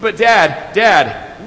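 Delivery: high, loud wail to Dad; retold loud bawling. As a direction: imitate a bawling boy. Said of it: "more loud bawling".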